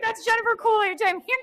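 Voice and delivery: high, breathy-free mock Coolidge voice; a quick mock Jennifer Coolidge; imitating Jennifer Coolidge. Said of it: "I started doing Jennifer Coolidge".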